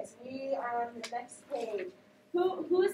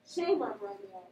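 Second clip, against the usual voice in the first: ordinary talk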